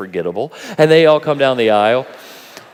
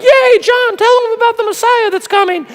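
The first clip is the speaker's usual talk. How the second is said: much higher squeaky fanboy cheer; cheering disciples; 7 dB louder than usual. Retold or imitated. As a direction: play the giddy superfan. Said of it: "who were all like".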